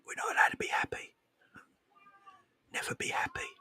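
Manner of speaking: playful ASMR whisper throughout. Pretend. Streamer to chat about angry fans, whispering. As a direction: keep it hushed and secretive. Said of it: "We're gonna whisper".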